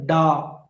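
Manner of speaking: "da" as the weak beat; unstressed syllable. Shown as a demonstration. Teacher showing the class the unstressed "da". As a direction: say "da" without any punch. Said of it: "the first is unstressed".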